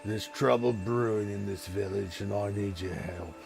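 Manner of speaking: a hint of a troubled ogre chieftain asking for help; ogre lord. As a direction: ogre ruler, worried, asking the party for help.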